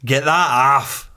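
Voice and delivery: slow, drawn-out copper's order; slow mock police command. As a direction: play the stern copper barking it slowly. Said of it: "he goes like that".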